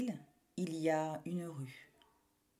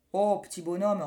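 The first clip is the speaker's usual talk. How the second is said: baker voice; 7 dB louder than usual. Acted. Baker answers boy.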